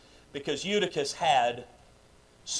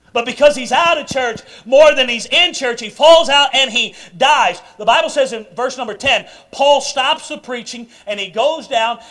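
loud, high-pitched sermon delivery; full-force preaching; 6 semitones higher, 10 dB louder than usual. Acted.

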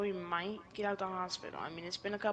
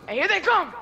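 loud, high warning yell; shouting; 11 semitones higher, 13 dB louder than usual. Pretend. Warning partner of enemies.

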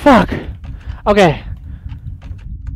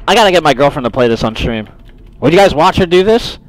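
normal talking voice

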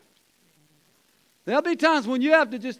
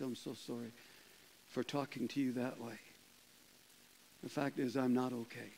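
tearful apology; 10 semitones lower, 17 dB quieter than usual. Retold or imitated. Coworker apologizing, about to cry.

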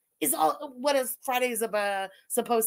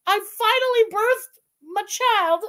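high, loud, slow proclamation; mock proud mother; 8 semitones higher, 6 dB louder than usual. Mother presenting her plaque as a baby, mock-grand announcement.

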